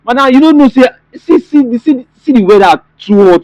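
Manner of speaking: irritated. Acted. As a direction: push back at her, fed up.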